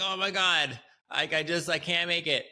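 high, strained wail of a worn-out student; mock dying student. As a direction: act out gasping you can't go on. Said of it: "You're dying and you're like".

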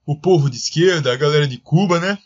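high-pitched lisping politician; Bolsonaro voice. Retold or imitated. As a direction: raise the voice, lisp the s. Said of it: "quem faz o Emílio consegue fazer o Bolsonaro".

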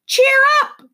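very high, loud squawk; squawking bird. A narrator's reading. Wiffle Bird cutting through the din, squawking. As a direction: screech it like a parrot. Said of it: "she squawked".

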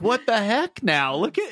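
baffled Houndor. Acted. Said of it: "yeah, Houndor's like".

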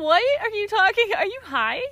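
loud, high retold disbelief; incredulous. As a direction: replay a baffled reaction, voice jumping up. Acting her incredulous reaction to boyfriend.

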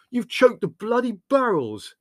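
high, loud, slow outraged yell; anguished fury, drawn out; furious yell. A narrator's reading. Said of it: "yelled Leslie furiously".

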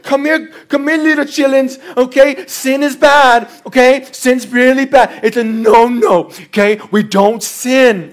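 put-on slow, drawn-out talk to little children; mock kindergarten-teacher patience; pastor babying congregation. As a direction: explain it as if to toddlers, stretching the key words. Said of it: "the pastor's job is like".

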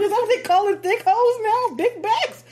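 high, incredulous question; half-laughing mock shock; mock disbelief. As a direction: act baffled by the new slang. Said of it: "I'm like".

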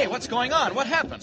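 high-pitched alarm; alarmed shout. Acted. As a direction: demand answers over the commotion. Kent runs into the commotion.

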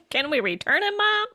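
laughing joke. Pretend. Host jokes as the son.

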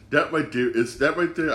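ordinary talk, nothing marked

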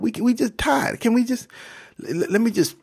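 high, mildly pleading tired fighter; worn-out angel. Acted. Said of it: "I'm tired".

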